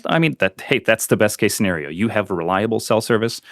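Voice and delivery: ordinary talk, nothing marked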